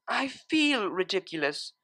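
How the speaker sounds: slow, soft sulky complaint; sulking. Acted. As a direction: sulk it out under your breath. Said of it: "she sulked".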